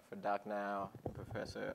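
ordinary talk, nothing marked

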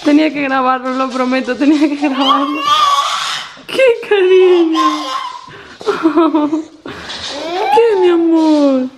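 almost crying with happiness; choked-up joyful cry; tearful. Real, not acted. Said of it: "que voy a llorar".

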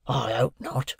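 put-on hushed old convict voice; a rough convict, keeping it hushed; Magwitch. A narrator's reading. Convict answers.

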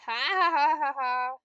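one loud, rapid run of ha-ha; loud ha-ha outburst. Real, not acted. Player outsmarted by the game, loud ha-ha. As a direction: burst out with a string of loud has. Reacting to the game's move.

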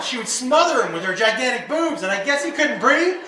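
ordinary delivery

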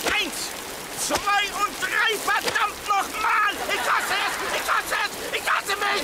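counting then raging at himself; frustrated outburst. Acted. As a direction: explode in frustration at yourself. Furious golfer after bad shot.

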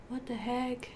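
ordinary delivery

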